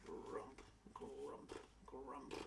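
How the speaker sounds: low, soft rhino grumble; grumbling rhino. A narrator's reading. Rhino answering Ellie's request, grumbling. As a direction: grumble under your breath like a rhino. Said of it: "the rhino grumbled".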